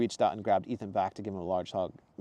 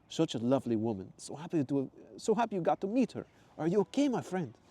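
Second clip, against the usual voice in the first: excited tourist in a put-on accent; Mateo's accent; 7 semitones higher than usual. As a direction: put on Mateo's foreign lilt. Mateo voiced.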